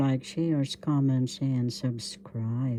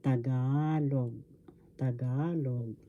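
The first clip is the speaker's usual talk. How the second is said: Tagalog with GA stressed and drawn out; stress on second syllable; 4 dB quieter than usual. Shown as a demonstration. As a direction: say Tagalog slowly, leaning on the middle syllable. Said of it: "the stress is in the second syllable".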